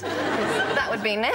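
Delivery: "née" stretched slowly like a horse's neigh; whinny the word "née". Shown as a demonstration. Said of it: "not the née a horse makes".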